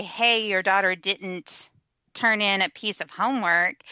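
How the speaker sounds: normal talking voice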